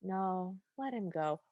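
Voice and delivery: soft, subdued mock-wistful resignation. Nostalgic king letting his son ride away, wistful. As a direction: give up quietly, lost in nostalgia. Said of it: "has a wistful look on his face again".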